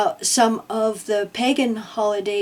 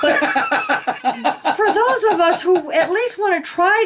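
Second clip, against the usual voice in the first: laughing; 6 semitones higher than usual. Real, not acted. Laughing while joking.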